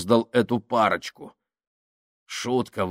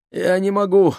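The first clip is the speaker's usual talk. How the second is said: high, louder whimpering cry; breathless whine; 10 semitones higher, 7 dB louder than usual. A narrator's reading. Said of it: "задыхаясь, проскулил Минхаус". (задыхаясь, скулить)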